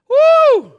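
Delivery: high, loud whoop of joy; celebratory whoop; excited woo. Real, not acted. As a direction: let out a big woo. Preacher exclaiming about Billy Graham.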